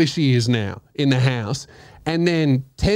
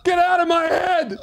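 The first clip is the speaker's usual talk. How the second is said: very high-pitched, strained cries; hammed-up outburst at a voice in your head; mock hysterical yelling; 13 semitones higher than usual. Mimics himself yelling as overheard.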